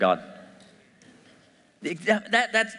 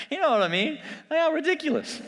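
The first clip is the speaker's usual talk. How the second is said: much higher, laughing through the words; 14 semitones higher than usual. Real, not acted. Preacher laughing at Abraham's obvious mistake, much higher. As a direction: crack up at how absurd it is.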